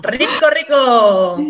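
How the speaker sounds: slow, laughing rico rico; laughing savour. Real, not acted.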